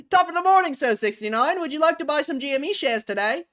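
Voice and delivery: high, loud newsboy holler. Pretend. Newsboy greeting a customer to sell shares, high hawking shout. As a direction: hawk it like an old-time newsboy. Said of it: "Just so I can talk in that voice".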